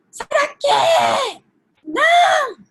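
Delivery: put-on high, loud childish yell; child's scream. Toddler shrieking at another child to go away, high and loud. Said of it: "ele não entende que quando ele grita".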